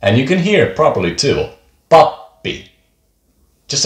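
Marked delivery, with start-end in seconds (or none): 1.9-2.7 pappi with a tiny break in the pp; tiny pause. Shown as a demonstration. Teacher letting learners hear the tiny pause in pappi. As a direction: pause briefly inside pappi, pap-pi. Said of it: "has a tiny pause".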